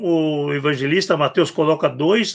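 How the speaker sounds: normal talking voice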